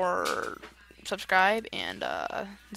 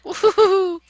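loud, high whoop of joy; real joyful holler; excited whoop; 11 semitones higher, 11 dB louder than usual. Real, not acted. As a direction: cheer the flight. Fast ride in game.

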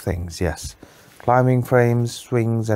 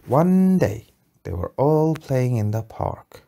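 slow, lilting storybook voice; story narration; 4 semitones higher than usual. A narrator's reading. Parent telling a bedtime story, lilting. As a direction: draw the child in with a sing-song opening.

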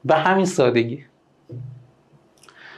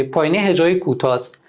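normal talking voice

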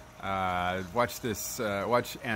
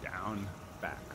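usual speaking voice

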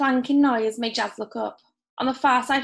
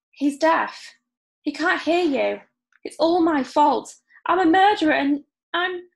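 despairing; 5 semitones higher, 4 dB louder than usual. Acted. Guilty boy despairing.

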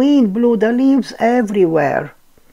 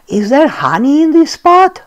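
eager; 8 semitones higher, 6 dB louder than usual. A narrator's reading. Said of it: "asked Pooh".

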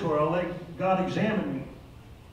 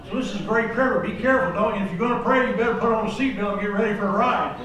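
louder preaching warning; full-force preaching; 4 semitones higher, 7 dB louder than usual. Acted. Preacher warning the flock about the seatbelt prayer, louder. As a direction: belt out the warning to be careful.

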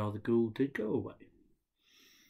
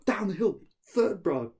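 excited; 9 semitones higher, 10 dB louder than usual. A narrator's reading. Louder, higher narration.

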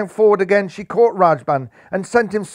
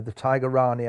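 usual speaking voice

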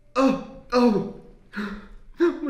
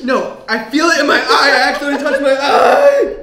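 loud; 5 semitones higher, 11 dB louder than usual. Real, not acted. Pepper in eye, panicked.

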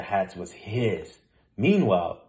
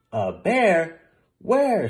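surprised; 7 semitones higher, 4 dB louder, much slower than usual. A narrator's reading. Policeman surprised.

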